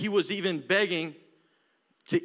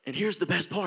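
ordinary talk

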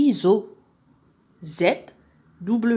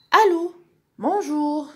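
much higher, lifted greeting; Ferida on phone; 9 semitones higher, 5 dB louder, slower than usual. Acted. Little girl answering the telephone, high character voice.

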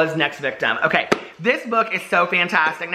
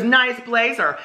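put-on higher-pitched mean-girl jab; quicker snide mean-girl repeat; mean sarcasm; 5 semitones higher, much slower than usual. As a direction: fake compliment, pitched up to mock. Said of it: "She's real mean".

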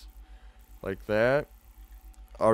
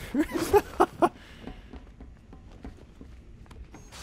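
long wheezing unvoiced laugh; breathless silent laugh. Real, not acted.

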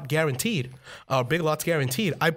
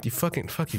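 ordinary talk

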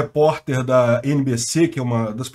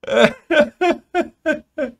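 long high laugh; 12 semitones higher than usual. Real, not acted. Laughing at the viral chant.

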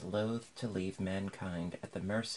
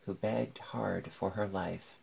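ordinary delivery, nothing marked

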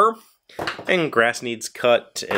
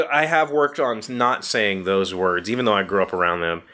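normal talking voice

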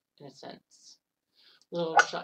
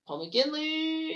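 slow, high-pitched name call; stretched, rising play-by-play call; drawn-out announcer call; 10 semitones higher, much slower than usual. Real, not acted. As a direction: announce him like a TV commentator.

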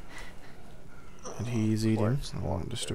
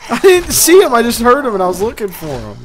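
loud high rattled rant; shaken outburst; 12 semitones higher, 13 dB louder than usual. Real, not acted. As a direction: blurt it out still jumpy. After jump scare, shaken.

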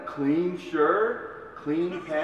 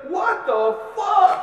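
slow, much higher mock shout; mother's shocked yell; 7 dB louder, much slower than usual. Mother yelling at her cleaned-up son, a mock shocked yell. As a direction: yell it like a stunned mom.

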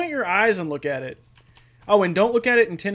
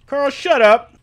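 higher, loud mock scolding; irritated shout; 7 semitones higher, 9 dB louder, slower than usual. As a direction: shout the pest down, in acted irritation. Voices guard silencing Carl.

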